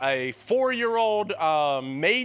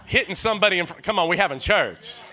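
loud, building church preaching; fired-up preacher, louder and louder; full-force preaching; 3 semitones higher, 4 dB louder than usual. Acted. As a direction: shout it to the back pews. Preaching about old-time church.

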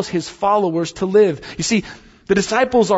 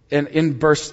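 normal talking voice

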